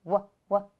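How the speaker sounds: "W, w" as the bare sound wuh, twice; "W, w" as separate starting sounds, not the letter name; isolated letter sound. Shown as a demonstration. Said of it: "What sound do you hear at the beginning".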